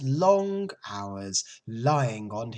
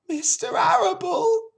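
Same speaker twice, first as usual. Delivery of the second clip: high, loud, distressed pig voice; sobbing piglet; 8 dB louder than usual. A narrator's reading. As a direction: ask as the upset little pig. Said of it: "sobbed Wilbur".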